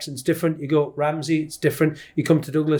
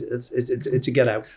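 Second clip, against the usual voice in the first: usual speaking voice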